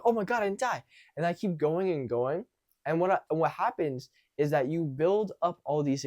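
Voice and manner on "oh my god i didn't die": relieved excited. Retold or imitated. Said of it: "then I'm like".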